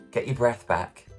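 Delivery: usual speaking voice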